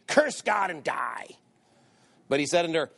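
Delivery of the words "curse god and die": slow, drawn-out dramatic quote; scornful wife. Acted. Job's wife urging him to curse God, scornful. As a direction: spit it out slowly, word by word.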